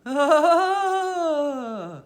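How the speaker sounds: high, odd thin laugh; a strange thin cackle out of nowhere; the silent cook suddenly laughs, queer and thin. A narrator's reading. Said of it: "the cook gave a queer, thin laugh".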